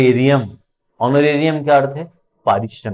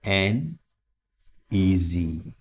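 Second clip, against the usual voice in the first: an and easy said separately, softly; split apart; 4 semitones lower, 5 dB quieter, much slower than usual. Shown as a demonstration. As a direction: pause between an and easy. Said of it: "an easy class".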